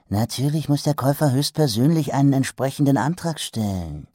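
sly trader. Acted. Trader's fine print.

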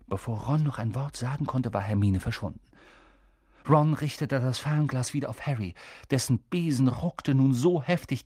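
ordinary delivery, nothing marked

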